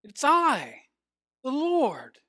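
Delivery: high, announcing voice from above; voice of the Lord. Acted. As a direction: call it down as the Lord. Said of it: "And the voice said".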